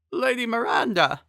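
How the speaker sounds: put-on high-pitched alarmed call of an old woman; old servant Impa spotting her lady, exclaiming. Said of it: "a familiar voice exclaimed".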